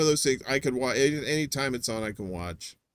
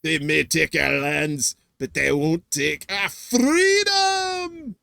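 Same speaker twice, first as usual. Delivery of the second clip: Scottish battle cry; 2 semitones higher, 3 dB louder, slower than usual. Acted. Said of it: "That's a good one".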